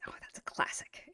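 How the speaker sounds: soft whisper; whispered aside. Real, not acted. Teacher whispers an aside about a common error. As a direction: whisper the comment.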